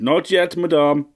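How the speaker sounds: shout. A narrator's reading. Butler shouting back to his mistress over the storm. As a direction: call back up through the house. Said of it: "Geoffrey shouted".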